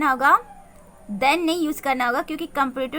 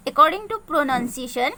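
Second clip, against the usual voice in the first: normal talking voice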